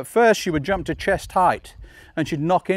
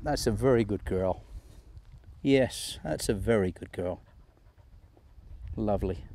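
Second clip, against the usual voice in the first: tender dog praise; 8 semitones lower, 9 dB quieter than usual. Real, not acted. Trainer praising her dog at the end, low soft coo. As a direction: lower and much softer, cooing.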